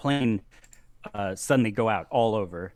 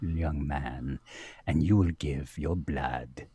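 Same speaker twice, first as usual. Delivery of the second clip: British vampire lady; 6 semitones lower than usual. Acted. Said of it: "and she says".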